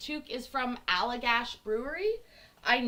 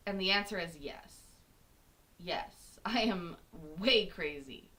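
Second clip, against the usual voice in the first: ordinary talk, nothing marked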